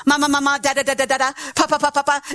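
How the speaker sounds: simple repeated syllables, steady and even; mama mama, da da da, pa pa pa; babbled syllables. Shown as a demonstration. Preacher demonstrating early tongues to the church, even babble. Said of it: "let it flow".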